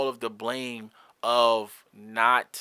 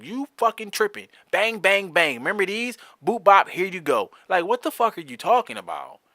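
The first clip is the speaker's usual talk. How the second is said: high, punchy mock bombardment; retold combative comeback; 6 semitones higher, 4 dB louder than usual. Parent finally dropping bombs in argument, combative. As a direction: fire off each jab like dropping bombs.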